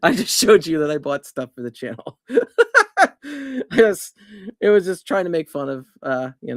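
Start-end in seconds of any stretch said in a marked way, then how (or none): 2.2-4.1 laughing through the words; breaking into laughter. Real, not acted. Laughing after the joke.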